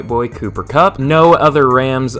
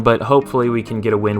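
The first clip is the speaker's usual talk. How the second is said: ordinary talk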